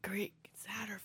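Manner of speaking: whisper. Pretend. Awed mention of the great Satterfield.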